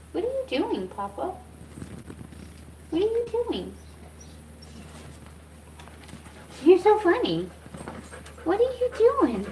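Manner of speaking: sing-song, very high pet-talk voice; high cooing. Real, not acted. Talking to Papa who wandered by, high-pitched cooing. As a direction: coo it high, as to a little one.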